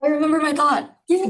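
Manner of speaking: delighted at remembering; pleased. Real, not acted. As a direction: celebrate a recovered thought, pitch up. Lost thought suddenly comes back.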